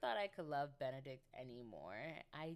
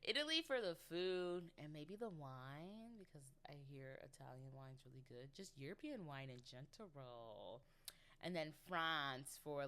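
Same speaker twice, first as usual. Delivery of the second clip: ordinary delivery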